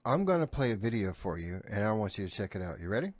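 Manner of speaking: normal talking voice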